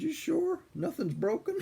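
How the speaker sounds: high-pitched imitation of an anxious kid; worried child. Retold worried child asking grandmother.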